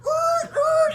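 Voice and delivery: a loud high squeal for the scraping chair; echoing chair squeal; imitated chair screech. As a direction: screech like a chair dragged across the floor.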